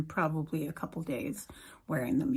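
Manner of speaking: soft unvoiced whisper. Real, not acted. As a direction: whisper it like a secret. Whispered reassurance about the lisp.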